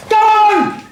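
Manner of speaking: barks it out loud and high; angry shout. Acted. Gunman shouting at the policeman to turn it on, loud angry order.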